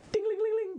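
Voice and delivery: high sung shop bell ding-a-ling; door chime. Acted.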